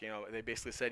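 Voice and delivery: ordinary delivery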